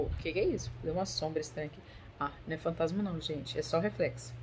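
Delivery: aparte em voz baixa. Real, not acted. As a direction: drop your voice, as if talking half to yourself. Aside on noticing a strange shadow. (aparte baixinho, em tom mais grave)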